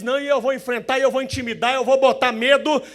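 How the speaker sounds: loud, high defiant boasting; defiant tough guy. Retold or imitated. Preacher mimicking a tough guy who defies authority, defiant. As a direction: puff up and challenge everyone. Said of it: "dá um de durão".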